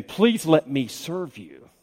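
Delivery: slow, soft, earnest plea; gently acted imploring; begging. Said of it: "He is begging them".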